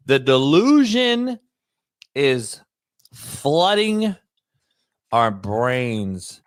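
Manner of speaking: slow, with a long pause between DELUSION, IS, FLOODING and OUR BRAINS; drawn-out emphasis. Real, not acted. Host driving home a point to his co-host, slow and halting for effect. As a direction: stretch DELUSION and FLOODING, stopping after each chunk.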